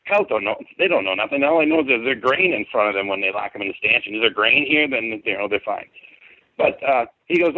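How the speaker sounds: ordinary delivery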